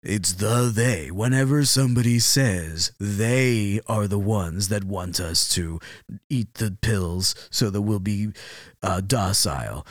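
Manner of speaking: slow, low presenter voice; portentous, drawn-out presenter delivery; smooth TV presenter. Acted. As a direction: explain the conspiracy in measured, silky broadcaster tones. Presenter explains who they are.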